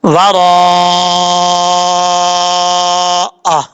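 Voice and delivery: ورا with the alif held many seconds; very long madd. Shown as a demonstration. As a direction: draw ورا out for a full long stretch. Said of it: "کیسے؟". (مد متصل کو پوری لمبائی سے)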